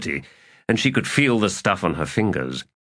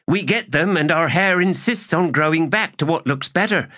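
high character voice, glibly explaining; cheeky soldier; 9 semitones higher than usual. Acted. Kisware excuses uncut hair to the captain, cheekily glib. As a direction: spin an excuse for the officer.

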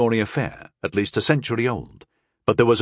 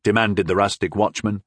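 ordinary delivery, nothing marked

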